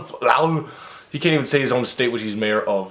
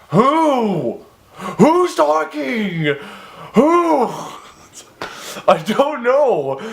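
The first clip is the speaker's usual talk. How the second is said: high, drawn-out baffled whos; baffled at who is speaking; exasperated confusion; 11 semitones higher, much slower than usual. Real, not acted. Reader baffled by the story's pronouns, calling out high.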